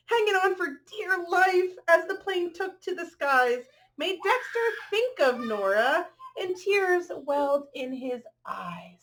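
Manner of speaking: bright, rhythmic picture-book read-aloud; reading to children. A narrator's reading. Reading a rhyming dog story to children, lilting storyteller voice. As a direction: read the rhyme to the little ones.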